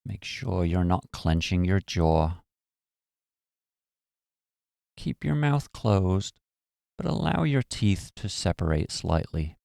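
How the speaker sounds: calm, even meditation voice with long pauses; guided meditation. Acted. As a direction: guide them gently into relaxation, phrase by phrase.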